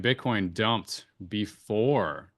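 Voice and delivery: slow, with BEFORE drawn out at the end; stress on BEFORE. Real, not acted. Analyst pointing out the timing of the dump, stress on BEFORE. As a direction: lean hard on the word "before". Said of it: "emphasis on before".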